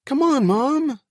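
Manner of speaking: put-on very high, loud child voice; urging boy. A narrator's reading. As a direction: urge Mom along like an eager little boy. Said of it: "the boy urged".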